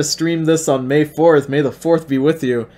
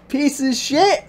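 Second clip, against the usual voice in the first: mock insult; 11 semitones higher, slower than usual. Mock outrage at the scene.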